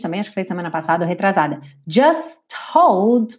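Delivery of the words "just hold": "Just hold" with no T, S straight to H; T dropped. Shown as a demonstration. Teacher shows the dropped T. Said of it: "sumiu com esse T".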